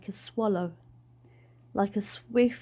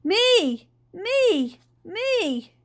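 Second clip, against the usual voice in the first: high, loud repeated cries; yelling; 9 semitones higher, 5 dB louder, much slower than usual. A narrator's reading. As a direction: yell eagerly to be picked. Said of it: "and yelled again".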